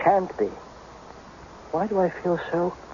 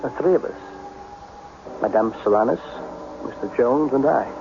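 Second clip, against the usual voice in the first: low, measured voice-over telling the tale; low inner narration; 3 semitones lower than usual. A narrator's reading. Joris narrating his own story. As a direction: draw the listener into his memory.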